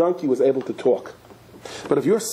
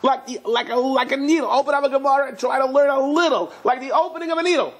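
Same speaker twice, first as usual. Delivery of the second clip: much higher, gentle pleading; voice of God pleading; 13 semitones higher than usual. Acted. God asking His people for a small opening, high and pleading. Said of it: "God says".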